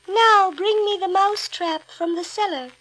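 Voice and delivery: high, slow kindly command; fairy godmother. A narrator's reading. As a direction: ask gently, magical and slow. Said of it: "The fairy waved her wand".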